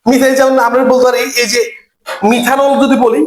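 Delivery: ordinary delivery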